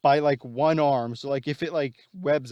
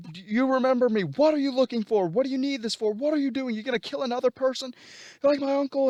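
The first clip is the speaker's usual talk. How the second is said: furious interrogation; 11 semitones higher than usual. Retold or imitated. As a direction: yell every question while beating him. Spidey beats uncle's killer.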